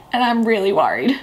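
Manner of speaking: whispered with a giggle; breathy giggling whisper; laughing whisper. Real, not acted.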